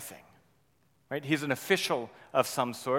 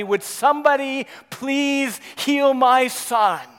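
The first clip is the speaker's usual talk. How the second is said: desperate plea; 7 semitones higher, 8 dB louder, slower than usual. Acted. Desperate father's plea voiced.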